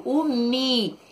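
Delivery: ordinary delivery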